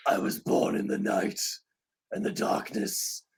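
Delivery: hushed, breathy brooding Batman; whispered Batman. Retold or imitated. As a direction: whisper it like a mock-tragic Batman. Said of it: "That was mine".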